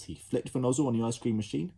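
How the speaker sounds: usual speaking voice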